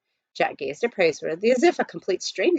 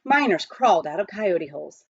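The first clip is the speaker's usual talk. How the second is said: ordinary delivery, nothing marked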